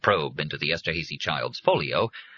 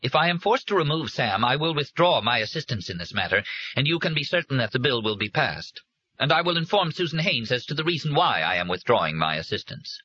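higher, firmer, threatening; gaining strength; 8 semitones higher than usual. A narrator's reading. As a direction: lay down an ultimatum. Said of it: "Her voice gained strength".

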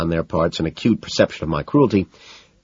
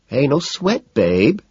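easy, higher-pitched reassurance; cool; 9 semitones higher, much slower than usual. A narrator's reading. Van Patten answers McDermott's tirade, cool. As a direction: play it cool, unhurried by the fuss. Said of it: "Van Patten says coolly".

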